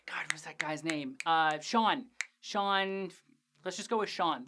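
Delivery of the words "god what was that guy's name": softly whispered to himself; whispered aside. Real, not acted. Host forgetting an actor's name, whispering to himself. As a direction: mutter it under your breath while trying to recall. Host searching memory mid-recap.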